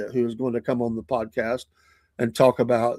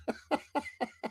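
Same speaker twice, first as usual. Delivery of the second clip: high squeal laugh; 13 semitones higher, 14 dB quieter than usual. Real, not acted. Laughing at taunting Denmark.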